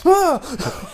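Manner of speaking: sudden high startled cry; startled yelp. Retold or imitated. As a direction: jolt awake with a yelp. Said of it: "you're like".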